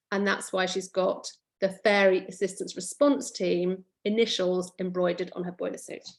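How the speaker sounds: ordinary delivery, nothing marked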